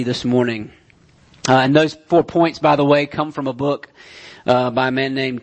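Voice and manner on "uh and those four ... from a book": ordinary talk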